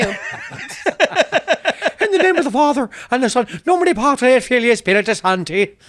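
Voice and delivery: high, loud mock Irish prayer; Irish prayer imitation. Quoting a movie prayer for a friend, in a loud mock Irish accent. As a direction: intone it like a Boondock Saints brother, Irish. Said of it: "I have two things to base my Irish accent on".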